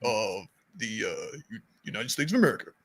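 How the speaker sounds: low, halting Obama presidential voice; Obama impression. Podcaster demos Obama voice for friends. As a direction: drop low and pause like Obama. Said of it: "He does that".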